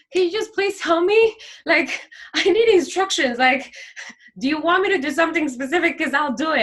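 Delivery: high, loud pleading to God; desperate plea. Retold or imitated. Speaker begging God, desperate high plea.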